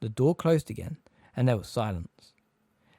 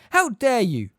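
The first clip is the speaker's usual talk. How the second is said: higher, loud outraged cry; furious shout; 11 semitones higher, 7 dB louder, slower than usual. A narrator's reading. McGonagall charging the attackers, higher furious shout. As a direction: yell it again, still running. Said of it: "the figure shouted as she ran".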